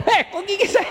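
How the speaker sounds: high squealing mock mother, laughing; a comic cry over teething; mother in pain. Preacher mimics a mother bitten while nursing, high and laughing.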